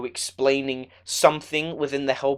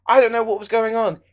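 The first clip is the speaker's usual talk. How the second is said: ordinary delivery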